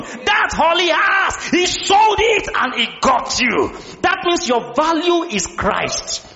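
high, slow, emphatic proclamation; full-force preaching. Real, not acted. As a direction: proclaim it to the whole congregation.

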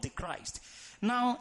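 usual speaking voice

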